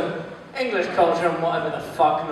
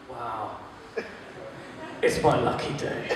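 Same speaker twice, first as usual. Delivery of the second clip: much softer, lower inner aside; inner thought; 9 semitones lower, 13 dB quieter than usual. Retold or imitated. Teacher privately thrilled by the student, low quiet aside. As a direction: hush it like a secret thought. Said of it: "I'm just thinking".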